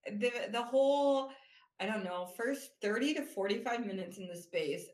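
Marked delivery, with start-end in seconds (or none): none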